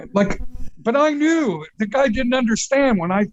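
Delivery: ordinary talk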